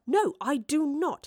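slow, high, firm refusal; defiant. Acted. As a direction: refuse firmly. Woodliffe defiant.

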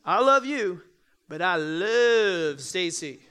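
loud LOVE, second one drawn slow with a laugh; stress on love. Shown as a demonstration. To the congregation, joking about his wife Stacy, stressing love. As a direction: punch love, then stretch but I love Stacy laughing. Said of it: "That's equivocally".

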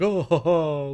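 a lightly voiced doofy chuckle; doofy happy noises. Guest mimics the grub father's doofy happy ho-ho. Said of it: "just really doofy, happy noises".

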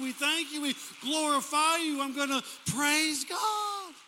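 slow stretched words rising into a loud call to praise; impassioned preaching. Real, not acted. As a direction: preach it up to a big finish. Preaching, building to shouted praise.

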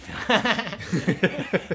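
slow, laughing echo. Real, not acted. Repeating his mate's line, slow and laughing. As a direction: drag it out through a laugh.

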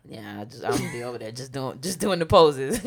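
ordinary talk, nothing marked

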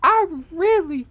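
high-pitched imitation of someone crying; mock tearful. Co-host mimicking the girl who started crying, mock tearful. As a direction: retell it in a high, weepy put-on voice. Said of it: "she started crying, like".

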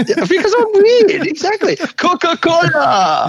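squeaky high laughing "Coca-Cola" drawn out slowly; overdone foreign pronunciation. Pretend. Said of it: "I liked it".

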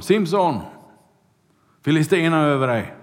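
loud, high alarm cry; calling out. Retold or imitated. The crowd shouting a warning to sleeping Samson, loud and high. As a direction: shout the warning across the room.

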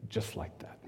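breathed out in a whisper. Real, not acted. Preacher on the sudden death, in a whisper. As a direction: let the words fall to a bare whisper.

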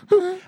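high, loud vocal boop; sharp high answering-machine tone; imitated machine beep. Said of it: "I'll be the answering machine".

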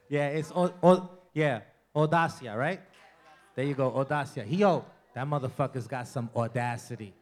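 ordinary talk, nothing marked

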